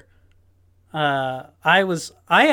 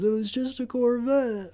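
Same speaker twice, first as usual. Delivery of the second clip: higher, softer mock quote; lightly voiced tough guy, pitched up; a hint of an action hero, pitched up; mock Vin Diesel line; 8 semitones higher, 4 dB quieter than usual. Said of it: "and he goes".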